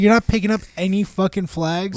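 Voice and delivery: high, exasperated retold question; getting annoyed. Boss to scammed employee about warning signs, annoyed. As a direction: press the point in disbelief. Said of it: "I'm, like, getting anno".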